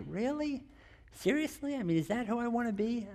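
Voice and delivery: high, soft disbelief; incredulous self-questioning. Retold or imitated. Father catching himself being harsh, incredulous self-questioning. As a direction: gently challenge yourself, voice lifted.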